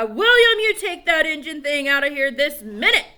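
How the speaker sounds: high, loud furious yell; angry shout. Acted. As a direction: bellow at the boy to get out. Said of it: "he'd cry".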